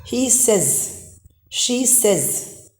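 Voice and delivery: SAYS said as "sez"; short-vowel says. Shown as a demonstration. Teacher models says after adding S, pronounced "sez". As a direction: rhyme "says" with "fez", short e. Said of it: "the pronunciation changes".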